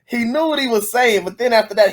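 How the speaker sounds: usual speaking voice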